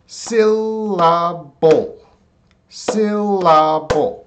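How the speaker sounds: "Syllable" very slowly as SIL-la-bull; "Syllable" sounded out part by part, sil-la-bull; three drawn-out syllables. Shown as a demonstration. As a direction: stretch "Syllable" into three long beats, high to falling.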